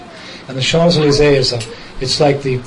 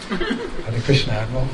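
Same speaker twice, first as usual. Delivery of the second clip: sheepish greeting; 8 dB quieter than usual. Retold or imitated. Meek greeting in front of crowd.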